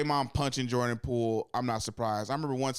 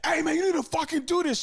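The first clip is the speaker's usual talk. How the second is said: high, loud, confrontational bark; Draymond in your face; 5 dB louder than usual. Acted. Vocal leader getting in a teammate's face, aggressive yelling. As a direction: go overboard chewing out a teammate. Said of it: "Draymond will get in your face".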